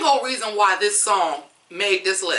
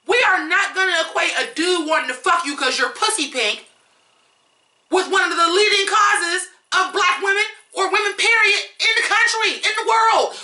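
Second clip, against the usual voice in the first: outraged rant; 8 semitones higher than usual. Real, not acted. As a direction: tear into her in disbelief. Outraged rant over the lyric.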